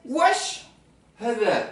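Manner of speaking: Wesh thrown out high and loud; Maghrebi dialect. Shown as a demonstration. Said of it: "ils vont dire wesh".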